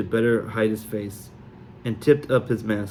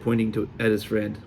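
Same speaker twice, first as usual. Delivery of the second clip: plain reading voice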